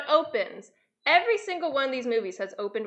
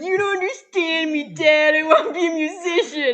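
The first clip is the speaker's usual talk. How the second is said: higher, louder whining son; hammed-up plea to a stern dad; whiny teen son; 6 semitones higher, 6 dB louder than usual. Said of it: "he's like".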